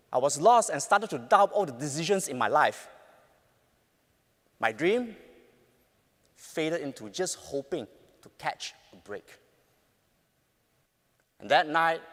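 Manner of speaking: ordinary delivery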